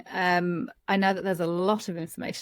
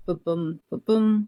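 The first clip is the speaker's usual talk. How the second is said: heartbeat pat sound. Shown as a demonstration. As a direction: tap out a heartbeat with the voice. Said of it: "do a heartbeat pat".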